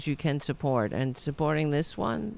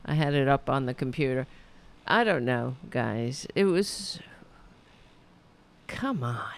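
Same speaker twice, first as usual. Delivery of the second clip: usual speaking voice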